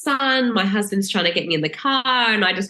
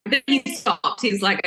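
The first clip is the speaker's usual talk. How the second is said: ordinary delivery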